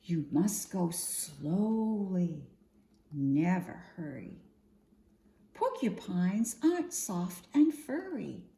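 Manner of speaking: plain reading voice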